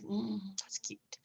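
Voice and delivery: soft, high cooing whisper; charmed whisper. Retold or imitated. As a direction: whisper it like you're melting a little. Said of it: "a little more like".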